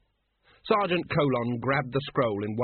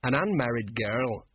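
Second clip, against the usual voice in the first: ordinary delivery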